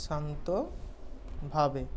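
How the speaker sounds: soft, slow and calm; calmly. Shown as a demonstration. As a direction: say it very calmly, drawn out. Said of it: "শান্ত, শান্তভাবে". (শান্তভাবে, আস্তে আর নরম করে)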